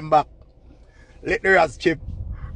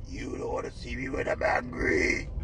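much lower, slow, whispered; low whispered threat; 10 semitones lower, 8 dB quieter, slower than usual. Retold or imitated. Vlogger hamming Spice's warning in a low whisper. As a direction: drop deep and whisper the warning. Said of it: "quoting Spice again".

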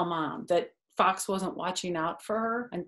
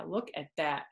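usual speaking voice

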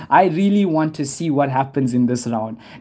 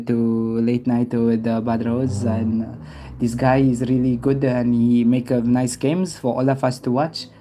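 put-on French accent; 4 semitones lower than usual. Streamer mocking viewers who laugh at his accent, French accent. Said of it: "You want me to talk like I'm from, from France?".

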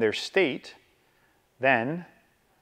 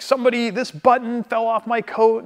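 much higher, indignant complaining customer; irate lady; 9 semitones higher than usual. Retold or imitated. Irate lady complaining to the tailor, high and indignant. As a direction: play the furious customer storming in. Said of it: "crazy irate lady".

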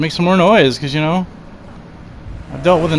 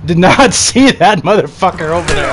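ordinary talk

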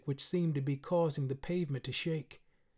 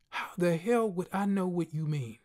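ordinary delivery, nothing marked

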